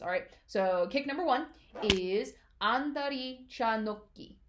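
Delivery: ordinary delivery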